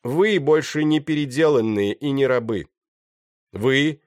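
high, loud public declaration; proclaiming. A narrator's reading. Pirate freeing the slaves, calling out loudly. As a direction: announce it to a whole crowd.

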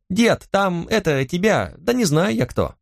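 put-on high, hesitant boyish voice; young grandson, unsure who is asking; boy calling grandpa.

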